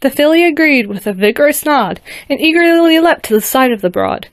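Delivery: lively rhyming storybook reading; story narration. A narrator's reading. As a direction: read it like a bedtime rhyme. Rhyming story read aloud.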